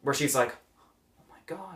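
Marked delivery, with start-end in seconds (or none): 1.2-1.7 hushed, awestruck whisper; imitating a stunned whisper; whispered revelation. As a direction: whisper it as the realization dawns. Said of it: "she has a look of revelation".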